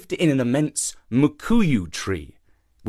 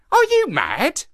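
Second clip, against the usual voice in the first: high-pitched incredulous question; incredulous query. A narrator's reading. Monkey questions the shark's sanity, incredulous query. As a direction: ask it high, in disbelief. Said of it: "queried Kima".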